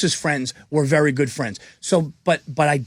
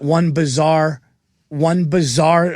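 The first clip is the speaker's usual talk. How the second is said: slow deliberate reading; much slower than usual. Retold or imitated. Reading out Gobert's tweet.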